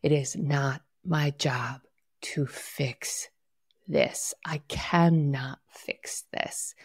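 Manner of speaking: slow, soft whisper to yourself; slow whispered mantra. Shown as a demonstration. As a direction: breathe the words out slowly, calming yourself. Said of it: "say to yourself".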